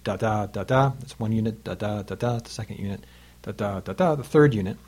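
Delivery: "Da, da, da, da" chanted short long in meter; iambic rhythm. Shown as a demonstration. Teacher demonstrates iambic trimeter beats to students. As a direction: tap out each iamb as a beat. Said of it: "pure iamb is short long".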